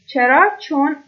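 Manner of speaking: ordinary talk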